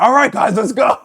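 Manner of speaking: loud, high rally call to the team; coach shout. Retold or imitated. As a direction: yell it like a fired-up strength coach. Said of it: "And he'd be like".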